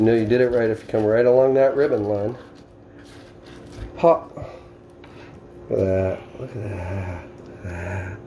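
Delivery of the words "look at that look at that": normal talking voice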